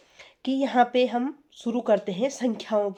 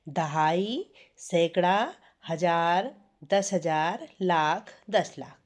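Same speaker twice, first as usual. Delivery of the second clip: dictating slowly; 3 semitones lower, slower than usual. Shown as a demonstration. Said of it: "इसको note कर लो".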